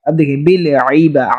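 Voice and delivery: ordinary talk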